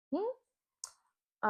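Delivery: high puzzled hum; a curious, suspicious hmm. Retold or imitated. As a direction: quizzical hum at the screen. Mock puzzled hum at phone.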